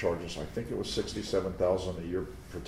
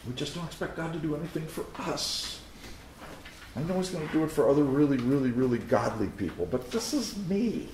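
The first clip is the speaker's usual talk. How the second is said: mock self-pity; 8 semitones higher than usual. Mocking doubter's self-pity.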